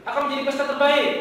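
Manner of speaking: chirpy, fast, eager line; excited comic character. Acted. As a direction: gush like a cartoon host who can't wait. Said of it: "Ini kan jadi satu panel kan".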